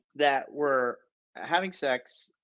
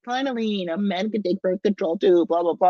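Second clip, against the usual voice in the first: high, whiny mock of the friend, louder by the end; mocking quote; 6 semitones higher, 3 dB louder than usual. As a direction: sneer it out like a know-it-all. Mocking a friend's feminist line.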